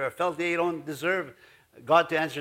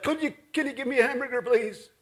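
hammed-up preacher tone ordering food, higher and fast; a touch of holy voice at the diner; mock preachy voice; 6 semitones higher than usual. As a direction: playfully put on the pulpit voice for a hamburger order. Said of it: "You don't talk like that".

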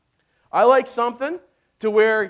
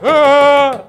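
short loud mock scream of panic; mock panic yell; 4 semitones higher, 11 dB louder than usual. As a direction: yelp like someone hitting the panic button. Acting out panic.

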